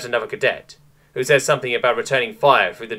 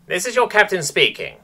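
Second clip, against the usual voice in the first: higher, commanding ship captain; captain's voice; 8 semitones higher than usual. A narrator's reading. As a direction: address the crew as their captain. Captain over the intercom.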